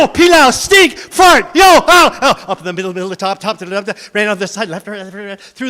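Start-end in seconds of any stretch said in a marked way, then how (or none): 0.0-2.4 loud, high silly shouting; silly yell. Acted. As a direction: blast out the weird spell. Boy's silly secret weapon.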